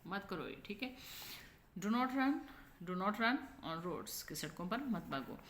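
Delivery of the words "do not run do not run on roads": deliberate command pressing "do not"; focus on DON'T. Shown as a demonstration. Teacher modelling a command, stressing "do not". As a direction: stress "do not" and space out the words. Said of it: "don't पे हम focus करते हैं".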